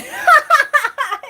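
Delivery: high, loud burst of laughter; real loud laugh. Real, not acted. Laughing at the me-and-you pinky line, loud high laugh. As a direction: burst out in a high, staccato cackle. Laughs at her own mimicry.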